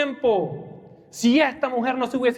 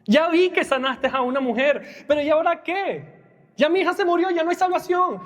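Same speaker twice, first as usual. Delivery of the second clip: higher voice, a hint of hopeless protest; the listener's imagined objection, voiced higher in mild despair; despairing father; 7 semitones higher than usual. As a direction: lightly voice a grieving father who has given up. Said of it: "Y tú dirás".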